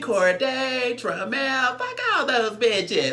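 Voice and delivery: loud, high, over-the-top diva tirade; Dre Simber. Retold or imitated. Retelling a castmate's tirade, hollered comic impersonation. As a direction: stretch the names, then holler the threats high. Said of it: "I'm gonna talk in his voice".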